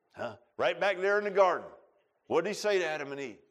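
normal talking voice

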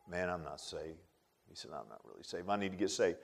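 very soft, low confession; retold whispered-quiet confession at the altar; hushed confession. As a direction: barely voice the admission. Retelling Harry's confession at altar.

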